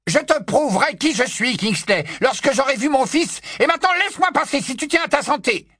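high, forceful angry yell; furious shouting. A narrator's reading. Mr Weasley demands to see his son, furious shouting. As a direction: shout it, rushing at the end. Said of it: "n'avait jamais entendu Monsieur Weasley crier".

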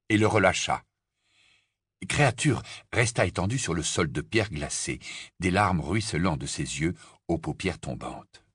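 usual speaking voice

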